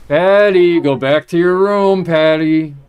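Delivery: loud, high commanding yell; shouting. Real, not acted. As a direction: order her back to her room. Shouting order at mother.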